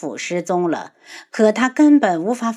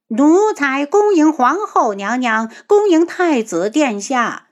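shrill palace servant; 5 semitones higher than usual. Acted. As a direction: call out like a palace eunuch. Eunuch announcement.

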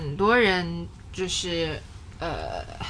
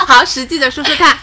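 laughing; 5 semitones higher, 9 dB louder than usual. Real, not acted. Laughs then teases.

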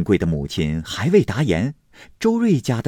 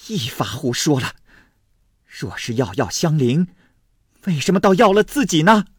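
indignant rebuttal; 7 semitones higher than usual. A narrator's reading. Mother rebuts the maid's account.

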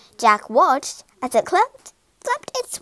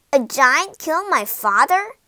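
louder, rising disbelieving question; shocked gasp; 3 semitones higher, 6 dB louder than usual. A narrator's reading. Jack hears of his father's death, shocked gasp. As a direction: gasp it out, appalled. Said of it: "gasped Jack".

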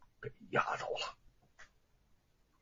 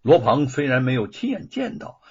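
plain reading voice

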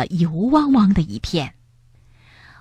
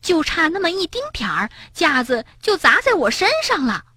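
high-pitched, quick complaint; cross; 9 semitones higher than usual. A narrator's reading. Bengbeng nearly hit by the shelf, cross. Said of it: "蹦蹦生气地说".

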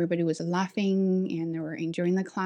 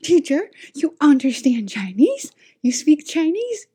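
high, loud mock astonishment; shocked students; 8 semitones higher, 7 dB louder than usual. Two students discover their teacher speaks Chinese, mock shock. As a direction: imitate kids stunned by their teacher. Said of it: "they were so shocked".

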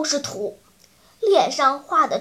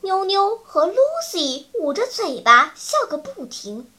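usual speaking voice

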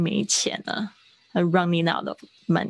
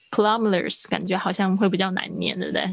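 ordinary talk

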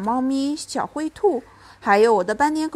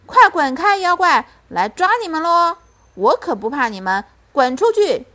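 higher, louder storybook shout; 6 semitones higher, 6 dB louder than usual. A narrator's reading. Child narrator chasing monsters downstairs, shouting loudly. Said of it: "我大喊道".

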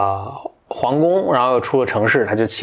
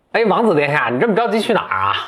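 higher, sly dwarf voice; cheeky dwarf; 7 semitones higher than usual. Acted. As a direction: tease the prince like a cheeky dwarf. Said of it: "这侏儒很贱". (贱兮兮的侏儒腔)

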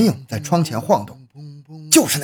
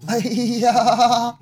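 pained cry; 8 semitones higher than usual. Acted. Man stabs himself.

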